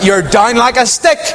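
high, fast hammed-up scolding; mock tirade. What Abigail could have screamed at David, high mock tirade. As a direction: rant at him like a shrew. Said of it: "scream and shout at him".

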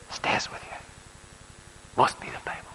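barely voiced, deeply moved; moved whisper. Real, not acted. Preacher echoing the waitress, in a moved whisper. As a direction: let it fall to a whisper.

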